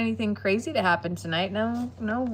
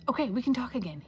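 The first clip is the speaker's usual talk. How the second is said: normal talking voice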